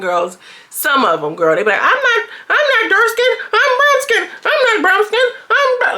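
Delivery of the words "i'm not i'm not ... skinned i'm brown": mocking high girly voice. Vlogger mocking girls who deny their skin tone, in a high squeal. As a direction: squeal it like a girl protesting too much.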